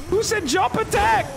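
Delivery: very high-pitched yelled question; startled yell. Real, not acted. Gamer caught by a jump attack, startled yell. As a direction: yell it out in outraged shock. Yelling after being jumped.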